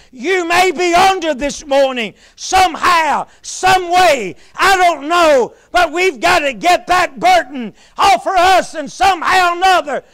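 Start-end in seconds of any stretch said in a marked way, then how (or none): none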